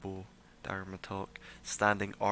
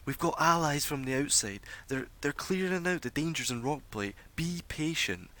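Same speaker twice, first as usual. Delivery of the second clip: lightly raised, steady reassurance; calm reassurance; 6 semitones higher than usual. Acted. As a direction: soothe the mob with promises. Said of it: "calms herself ... and says".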